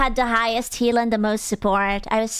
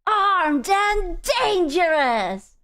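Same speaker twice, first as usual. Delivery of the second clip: high, slow mock hero shout; retelling teammate's hero lines - high, stretched mock shout; Bucky's voice lines; 8 semitones higher, much slower than usual. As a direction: ham up the character's battle cry. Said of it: "He was like".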